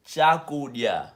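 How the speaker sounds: Chakudya said slowly, each syllable stretched; Chakudya, loud, slow and clearly sounded out; slow, drawn-out pronunciation. Shown as a demonstration. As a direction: slowly model Chakudya for learners. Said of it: "I gotta say that first".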